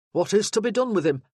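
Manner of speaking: a burly sergeant taking charge; booming soldier arriving in force; gruff sergeant. Acted. Sergeant to Jonathan.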